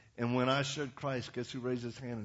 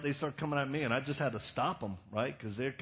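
ordinary talk

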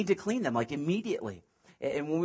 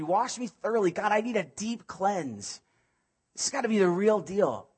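higher and louder pleading cry; David's cry; 5 semitones higher, 4 dB louder than usual. Acted. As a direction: cry out for cleansing, urgent. Preacher voicing David crying out.

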